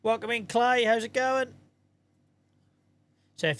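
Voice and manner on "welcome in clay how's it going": bright, high welcome to a viewer; excited greeting. Real, not acted. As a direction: call out a cheerful welcome.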